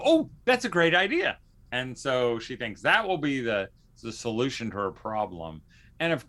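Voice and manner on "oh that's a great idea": high, bright-eyed mock enthusiasm; an imitated sandpiper mom gushing over a tip; excited mock gush. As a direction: gush over the idea, pitch up. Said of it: "a sandpiper will go".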